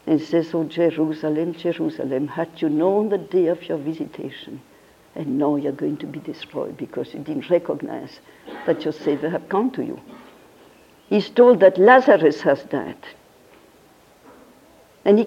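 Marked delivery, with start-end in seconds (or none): 0.6-10.0 quoting a weeping Christ, much softer and lower; a hushed, grieving lament over the city; mournful lament. Retold or imitated. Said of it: "and he starts crying".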